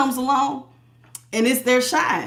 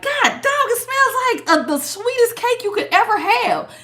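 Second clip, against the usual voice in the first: drool over a sweet cake scent, pitched up; delighted gush; 10 semitones higher than usual. Retold or imitated. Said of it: "And I was like".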